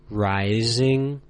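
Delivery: "rising," with the pitch sliding upward. Shown as a demonstration. Teacher showing learners the second tone, voice climbing. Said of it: "They are spoken as".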